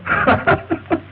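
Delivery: high, breathy chuckle; amused laugh. Acted. Man explaining alarm-clock joke, laughing. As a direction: laugh at your own prank. Laughs at his own prank.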